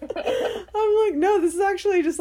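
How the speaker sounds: high, quick, laughing reply; loud, giggly rush of words; talking through laughter. Real, not acted. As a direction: protest while still cracking up. Replying while cracking up.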